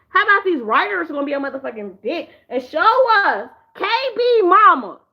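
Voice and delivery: ordinary talk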